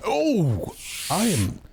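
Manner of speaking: high startled oh, caught off guard; startled gasp. Acted. Player's character startled after sitting on a cape. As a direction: blurt it out in shock.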